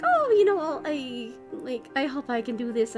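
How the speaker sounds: normal talking voice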